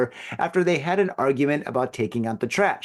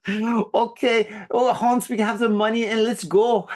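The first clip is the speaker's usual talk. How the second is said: high-pitched mock German accent; hammed-up German robber calling his accomplice; 6 semitones higher, slower than usual. Shown as a demonstration. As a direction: put on a silly German accent. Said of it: "like, a German accent?".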